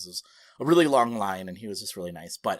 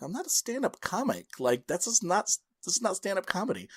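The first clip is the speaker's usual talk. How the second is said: mild protest; 8 semitones higher than usual. Retold or imitated. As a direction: object under your breath, higher-pitched. Protesting his introduction as stand-up.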